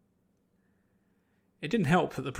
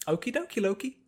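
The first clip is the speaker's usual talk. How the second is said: higher, bouncy and cheerful; sing-song cheerful agreement; chirpy Pinkie; 7 semitones higher than usual. Acted. Pinkie agreeing eagerly to the priest, high and bouncy.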